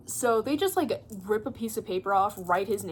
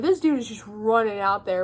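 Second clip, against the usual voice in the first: usual speaking voice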